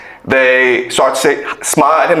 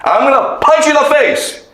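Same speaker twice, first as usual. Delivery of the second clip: higher, pushier bully voice, apologize stretched; angry bully threat; 9 semitones higher than usual. Retold or imitated. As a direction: threaten the new kid, a hammed-up bully. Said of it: "the bully goes in front of the new kid and says".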